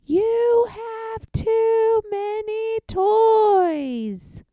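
high, loud, slow drawn-out wail; exasperated yell. A narrator's reading. Fed-up mom yells at son about his toys. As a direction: stretch it out in fed-up despair. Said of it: "she yelled".

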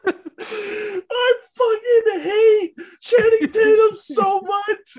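high, slow sing-song wail through laughter; laughing mock wail. Real, not acted. Mock-hating Channing Tatum while laughing, sung high wail. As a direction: wail it half-singing, cracking up. Mock outrage at funny actor.

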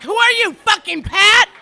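loud, high shout at someone; furious shouting demand; shouted challenge. Acted. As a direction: yell it out in outrage. Opening shout at heckler.

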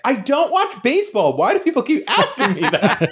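a pretend Cordy, put out and indignant; mock annoyed Cordy. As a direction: play Cordy fed up with the question. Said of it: "and she's like".

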